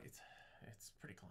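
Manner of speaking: very soft, near-whispered verdict; acted hushed reluctance; muted aside. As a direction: say it under the breath. Acting out reading the script.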